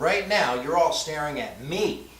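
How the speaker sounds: "me" punched at the end; stress on ME. Shown as a demonstration. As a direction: run up to "me" and hit it. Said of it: "placed the emphasis on a different word".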